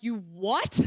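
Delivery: re-enacted outrage with a swooping rise; shocked disbelief. Mentor reacting to a shocking phone confession, retold disbelief. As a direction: swing the pitch up in mock disbelief. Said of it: "getting angry 'cause I'm like".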